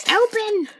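high, sharp cry of Open! at the stuck box; frustrated yell. Real, not acted. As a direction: yell at the packaging to give way. Yelling at a stubborn box.

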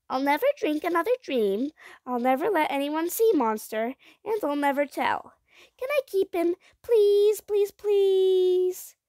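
put-on high, pleading little-girl voice; high-pitched childish begging; eager child. A narrator's reading. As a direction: promise eagerly, then beg with stretched pleases. Child recites promises, begs.

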